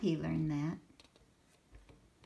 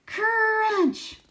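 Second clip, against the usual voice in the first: loud, much higher crunching noise; 13 dB louder than usual. Acted. Sammy eats the classroom furniture with a loud crunch. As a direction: chomp it out loud.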